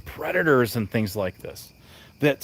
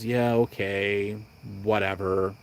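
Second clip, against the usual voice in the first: slow, softer, lower brush-off; dismissive shrug; 5 semitones lower, 7 dB quieter, slower than usual. Retold or imitated. Imitating people shrugging off a warning, dismissive. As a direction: shrug it off like it doesn't matter. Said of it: "shrugs it off and says".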